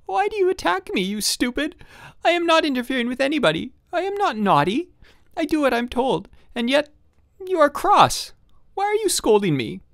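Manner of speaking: very high, tearful protest; boy crying at his scolding father, high tearful voice; weeping child. A narrator's reading. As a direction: plead through tears like a wronged boy. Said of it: "wept so bitterly".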